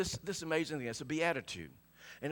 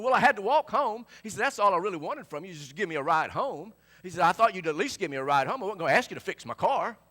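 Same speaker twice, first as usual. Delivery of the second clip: loud, raised voice, hurt and accusing; retelling an angry friend's complaint, loud; Billy angry; 5 semitones higher, 11 dB louder than usual. Retold or imitated. Stranded friend complaining he walked home, loud and angry. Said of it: "And it really made him mad".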